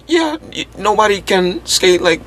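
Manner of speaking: very high mock squeaky skater voice; hammed-up Ronnie Newman impression; imitating Ronnie Newman. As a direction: pitch way up, a cartoonish skater boast. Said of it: "he does not speak like that".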